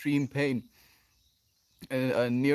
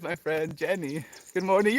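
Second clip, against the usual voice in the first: ordinary talk, nothing marked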